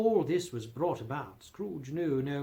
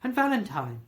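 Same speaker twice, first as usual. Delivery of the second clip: higher, louder burst of recognition; extraordinary excited voice; 5 semitones higher, 6 dB louder than usual. A narrator's reading. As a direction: recall an old friend with delight. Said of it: "in a most extraordinary voice".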